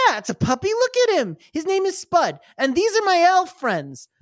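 put-on high-pitched little-boy show-and-tell; excited kid. Kid introducing pet and elves to brother, high excited voice. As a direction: gush high, proudly introducing everyone. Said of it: "And he goes".

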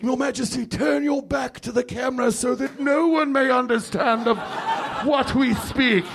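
hushed courtly aside; Game of Thrones character. Retold or imitated. Mocking unintelligible Game of Thrones court talk, British. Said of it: "characters that are like".

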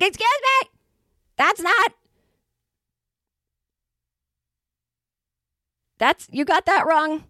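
high-pitched imitation of an indignant customer; objecting customer. Customer objecting to a cashier's mistake, high-pitched mock indignation.

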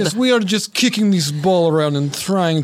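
put-on Russian accent. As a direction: play an innocent Russian crewman. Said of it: "Several Russians?".

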